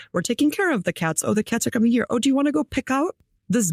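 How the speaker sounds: a hint of excited pet owners; imitating chirpy bosses. Guest imitates her bosses announcing new pets, lightly chirpy.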